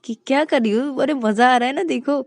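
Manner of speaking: thrilled driver. Acted. Driver enjoys speeding.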